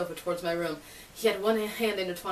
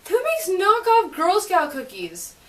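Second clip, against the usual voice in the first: indignant; 9 semitones higher, 7 dB louder, slower than usual. Real, not acted. Indignant at fake cookies.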